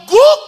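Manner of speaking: very high, loud woof; imitated dog bark. Dog signalling that it has understood, imitated bark. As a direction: let out one big mock bark. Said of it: "Begitu dia tahu".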